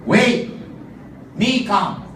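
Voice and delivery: loud high broken-English chief; hurried chief's pidgin promise. Acted. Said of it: "the chieftain told his wife".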